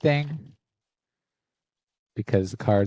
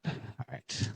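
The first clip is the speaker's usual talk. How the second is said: ordinary talk, nothing marked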